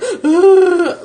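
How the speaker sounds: high, loud put-on groan; mock disgusted groan. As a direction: groan like a sore loser. Imitates Democrats losing.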